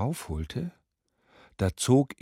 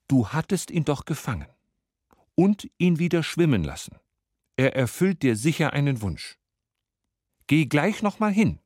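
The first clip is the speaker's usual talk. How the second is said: higher-pitched, pushy urging; insistent nagging wife; 5 semitones higher than usual. Acted. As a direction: press the husband to go at once. Wife pressing her reluctant husband.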